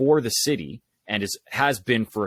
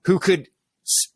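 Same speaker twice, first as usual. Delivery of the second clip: usual speaking voice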